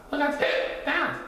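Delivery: high-pitched cub; high, hiccupy little bear; Little Bear character voice. A narrator's reading.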